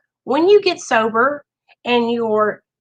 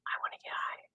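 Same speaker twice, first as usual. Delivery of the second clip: a faint breathy whisper, barely there; inner-voice whisper; 20 dB quieter, much faster than usual. Retold or imitated. Talking about recovery, the craving's quiet inner voice, whispered. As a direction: whisper it like the craving in your head. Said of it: "I say in my mind".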